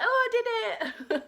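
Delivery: high, loud, laughing cheer of triumph; a big laughing victory cry; showing off. Pretend. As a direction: crow it out for the neighbours to hear. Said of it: "I'm like".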